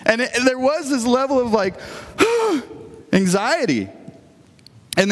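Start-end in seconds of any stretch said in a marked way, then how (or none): 1.8-2.7 put-on high, strained sigh of a flustered kid; anxious sigh. Boy lost hunting a wrench, sighing high and anxious. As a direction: let out a tense, overwhelmed sigh. Overwhelmed kid at dad's shop.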